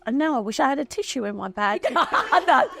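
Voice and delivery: laughing off tears, a touch fast; laughing through tears. Real, not acted. Guest wishing for a tissue, laughing through tears. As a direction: brush away the tears with a laugh. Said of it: "I'm not gonna get morose".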